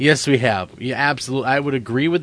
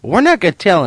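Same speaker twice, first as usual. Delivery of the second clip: TV host voice; 7 semitones higher, 6 dB louder than usual. Pretend. Mock host announcing severed head.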